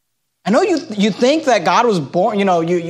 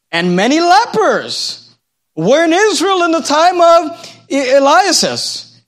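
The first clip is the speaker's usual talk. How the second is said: louder, drawn-out Bible reading; scripture reading; 8 semitones higher, 4 dB louder, slower than usual. Acted. Preacher reads a verse to the congregation, emphatic scripture reading. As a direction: read the verse out emphatically, stretching key words.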